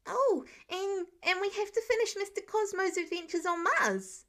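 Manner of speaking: high, eager kindergartner voice; tumbling out a child's reminder; excited little boy. Acted. Excited kid remembering the storybook.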